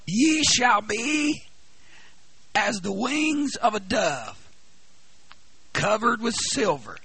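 slow, loud, drawn-out preaching; solemn scripture reading. Acted. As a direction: proclaim every phrase slowly. Reads scripture in preaching cadence.

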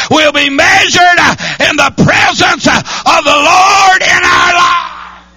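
loud, high shouted sermon cadence; hollering revival preaching; full-force preaching. Acted. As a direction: drive each phrase home to the congregation.

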